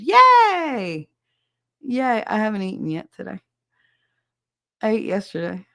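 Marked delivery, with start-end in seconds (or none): 0.0-2.2 high, loud drawn-out cheer; big celebratory squeal; excited cheer. Real, not acted. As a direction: whoop it out for their break. Cheering at a viewer's food break.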